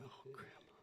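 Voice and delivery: soft unvoiced whisper; whispered thought. Grandson at his dying grandmother's bedside, whispering. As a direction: breathe it to yourself at the bedside. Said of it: "I thought".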